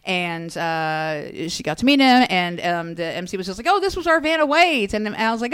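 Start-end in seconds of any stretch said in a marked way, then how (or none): 3.7-4.9 higher-pitched mock MC introduction; quoted cheery introduction. MC introducing a game-show girl to the star, mildly excited. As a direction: lightly imitate an upbeat host.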